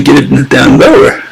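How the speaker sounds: ordinary talk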